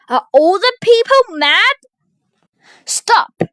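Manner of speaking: high, loud cry; loud, high-pitched exclamation; alarmed outburst. A narrator's reading. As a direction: shout over a brawl. Bewildered outburst, then Olivia halts fight.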